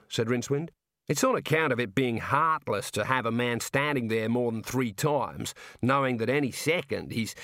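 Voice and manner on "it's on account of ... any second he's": jovial Aussie warder. Acted. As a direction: play a chummy outback prison guard. Warder explains hanging tradition.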